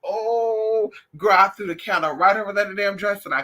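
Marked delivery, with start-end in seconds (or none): 0.0-0.9 startled oh's. Retold or imitated. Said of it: "I said".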